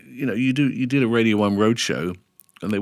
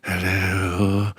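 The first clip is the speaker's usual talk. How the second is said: lightly put-on, drawn-out greeting; level, stretched hello in someone else's voice; Kenny Everett. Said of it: "and go".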